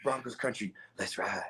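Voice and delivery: low, hushed slogan; understated catchphrase retake. Pretend. Host redoes the Broncos catchphrase for laughs, low and hushed. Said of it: "let me get that better".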